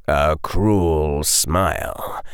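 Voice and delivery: plain reading voice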